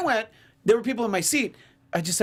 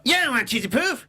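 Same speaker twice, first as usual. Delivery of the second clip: high-pitched cartoon brat impression; Eric Cartman; 7 semitones higher than usual. Friend joining in the cartoon impression, Cartman voice. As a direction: whine it like a cartoon kid. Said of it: "Kinda sounds like a Eric Cartman".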